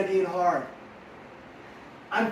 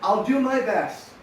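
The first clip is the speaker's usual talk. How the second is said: slow, steady answer; 3 semitones higher, 4 dB louder, slower than usual. Shown as a demonstration. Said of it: "How about you say this?".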